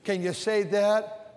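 ordinary talk, nothing marked

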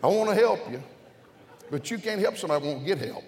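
loud, high preacher's exhortation; full-force preaching. Real, not acted. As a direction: call out to the crowd.

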